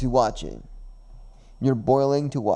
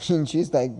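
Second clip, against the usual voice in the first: ordinary talk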